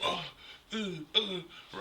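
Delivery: high mocking squeak of the girlfriend. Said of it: "this girl keep going".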